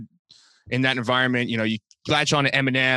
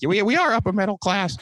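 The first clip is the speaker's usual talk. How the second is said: higher, mock-proud voice of his mom; proud mother; 7 semitones higher than usual.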